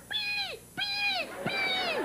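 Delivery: very high, soft squeaks; dolphin squeal. Acted. Dolphin answering the boy, high squeals. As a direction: imitate a dolphin's piercing chirps. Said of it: "The dolphin comes by".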